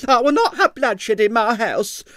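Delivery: high, loud, outraged; indignant panic. A narrator's reading. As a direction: forbid it in alarm. Landlady indignant.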